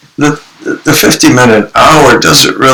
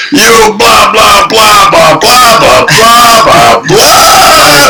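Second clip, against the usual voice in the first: high, loud shouted blah blah blah; mocking rant; 13 semitones higher, 3 dB louder, much slower than usual. Grown kid shouts at role-play father, high furious mock rant. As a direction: rant at him in a high yelling voice.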